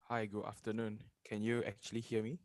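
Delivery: faint voice, far from the mic; barely audible. Real, not acted. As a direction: speak too softly for the mic. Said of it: "Your voice is very low".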